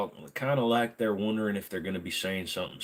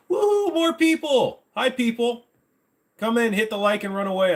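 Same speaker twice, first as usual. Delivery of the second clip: very high, louder welcome; squeaky excited squeal; 14 semitones higher, 5 dB louder than usual. Pretend. Streamer welcoming new viewers, very high squeal. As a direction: shriek with glee.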